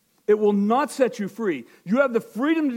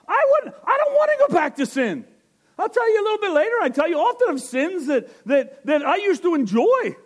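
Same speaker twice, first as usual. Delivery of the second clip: emphatic shout; 11 semitones higher than usual. Real, not acted. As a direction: cry out that you would never go back. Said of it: "insists he won't go back".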